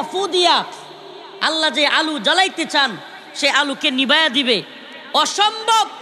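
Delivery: full-force waz preaching; fiery preaching. Acted. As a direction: drive the point home to the crowd.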